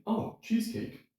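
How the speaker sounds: high, delighted "Oh, cheesecake"; a happy yes to cheesecake; pleased reaction. Retold or imitated. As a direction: brighten up at the idea of dessert. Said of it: "And I'm gonna say".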